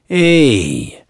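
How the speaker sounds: lightly voiced cabbie; a chatty cabbie's "Hey"; taxi driver voice. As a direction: taxi driver greeting the narrator.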